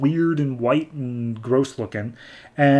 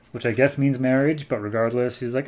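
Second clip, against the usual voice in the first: ordinary delivery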